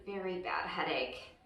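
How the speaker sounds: tired and quiet, like a pounding head; weary, quiet. A narrator's reading. Reading the story, a weary hushed line. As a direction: drop it low and soft, worn out. Tired Duck after a long day.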